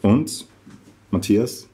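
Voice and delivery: measured recital with deliberate pauses between phrases; poem reading. Acted. Reading a satirical poem about Adiletten to the audience, deliberate poetry recital. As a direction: recite it line by line, letting each phrase land.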